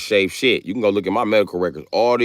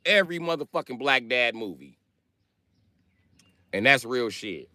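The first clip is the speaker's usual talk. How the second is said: normal talking voice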